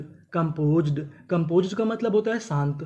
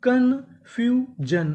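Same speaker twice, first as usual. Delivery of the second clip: slow drawn-out model word; 5 semitones higher, much slower than usual. Shown as a demonstration. Teacher sounds out confusion slowly for the class. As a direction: pull confusion out syllable by syllable. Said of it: "तो meaning क्या बनी?".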